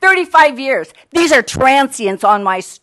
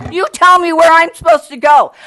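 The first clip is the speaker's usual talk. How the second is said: raised, loud and higher, demanding an answer; angry shout; 4 semitones higher, 6 dB louder than usual. Real, not acted. Homeowner shouting at the city council in real anger.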